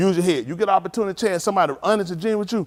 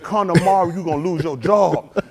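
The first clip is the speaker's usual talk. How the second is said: bluffing threat; 4 dB louder than usual. Retold or imitated. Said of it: "I'm bluffing".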